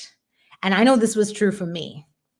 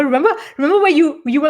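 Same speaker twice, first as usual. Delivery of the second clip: excited; 7 semitones higher, 5 dB louder, much faster than usual. Real, not acted. Urging Pat to recall fear.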